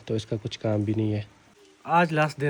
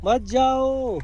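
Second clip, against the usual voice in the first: high, drawn-out mock begging; playfully whiny high-pitched plea; playful pleading; 11 semitones higher, much slower than usual. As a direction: beg a child to stay, hammed-up. Playfully begging child not to leave.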